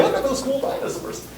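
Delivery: breathy whispered awe, high and soft; a teacher imitating a museum visitor whispering; whispered museum-goer. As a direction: mimic a hushed museum visitor gawking. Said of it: "you will overhear people say".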